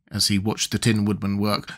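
ordinary delivery

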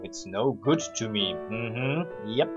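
quiet, mocking repeat of "no good to me"; deadpan mockery. Shown as a demonstration. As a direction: echo "no good to me" softly with scorn. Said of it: "Oh, wow".

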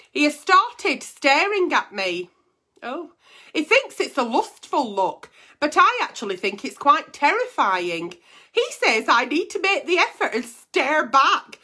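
letter reading. Acted. Reads a reader's letter aloud.

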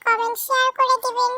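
usual speaking voice

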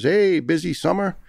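high sarcastic quip, voice jumping up; mocking doctor. Quoting the doctor mocking the patient, sarcastic and high. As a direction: mimic the doctor's snide jab.

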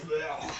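grossed-out blech; disgusted by taste. Real, not acted. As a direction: spit out the taste. After chugging pop.

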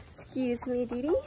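usual speaking voice

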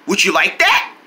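usual speaking voice

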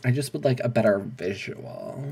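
ordinary delivery

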